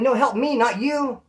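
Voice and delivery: exasperated mock cry for help; retold pleading yell. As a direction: call after someone running off. Retold plea to fleeing customer.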